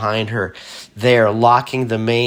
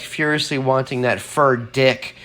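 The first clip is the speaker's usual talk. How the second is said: ordinary delivery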